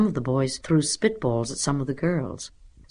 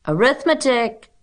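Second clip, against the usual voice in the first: high, loud chorus answer; children's shout; 10 semitones higher, 6 dB louder than usual. A narrator's reading. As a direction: call it out like keen pupils. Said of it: "shouted the children".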